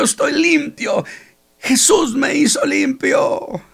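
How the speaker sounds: shouting happily. Acted. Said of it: "se oían los gritos de aquel hombre, emocionado".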